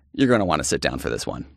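put-on a video host warning girls, dropping to an ominous hush. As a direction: drop into a grave warning. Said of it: "it's like".

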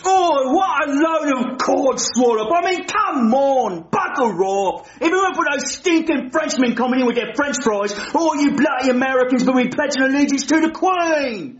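huffy put-on British accent; offended British accent; British accent, indignant. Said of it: "I don't like his accent".

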